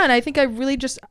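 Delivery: ordinary delivery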